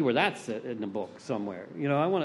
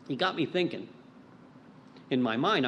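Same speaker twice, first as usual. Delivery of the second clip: ordinary talk, nothing marked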